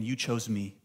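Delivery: low, soft, tender. Acted. Jesus to the distracted praying believer, soft and tender. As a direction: say it softly, moved.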